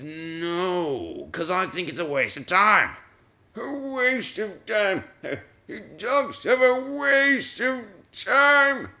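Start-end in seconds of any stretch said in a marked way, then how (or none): 3.6-8.9 high, drawn-out disbelief; slow high-pitched mock protest; mock incredulity. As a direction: stretch it out high, affronted by the phrase. Mock outrage at own phrase.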